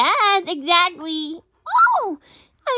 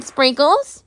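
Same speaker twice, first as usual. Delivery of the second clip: ordinary delivery, nothing marked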